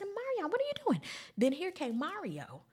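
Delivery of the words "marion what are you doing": high-pitched, loud disbelief; incredulous challenge. Retold or imitated. Viewer calling out the singer mid-show, high incredulous cry. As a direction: call him out in a high, loud squawk. Retold exasperated question at singer.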